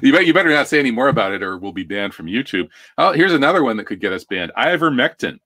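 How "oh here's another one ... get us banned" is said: ordinary talk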